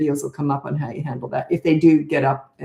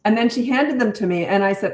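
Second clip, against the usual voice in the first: usual speaking voice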